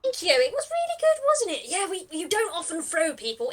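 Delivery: high, loud, chuffed voice; delighted bandit. Acted. Bandit thanks adventurer for praising the throw, delighted. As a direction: gush proudly at the praise.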